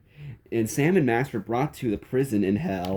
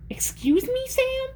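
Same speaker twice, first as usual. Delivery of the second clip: very high whispered geek voice. A narrator's reading. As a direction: whisper it in a squeaky nerd voice. The geek character speaks.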